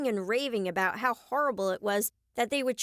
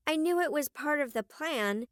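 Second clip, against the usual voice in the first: plain reading voice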